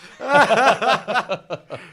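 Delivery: loud, high burst of real laughter; big laugh. Real, not acted. Laughing hard at his own joke to his mate, loud real laugh. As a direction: crack up loudly.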